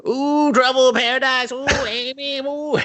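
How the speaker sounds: very high, mocking cackly taunt; Evil Ed taunting. Evil Ed taunts the couple as Amy walks past, very high and mocking. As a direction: tease them like a gleeful weirdo. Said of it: "Evil is just like".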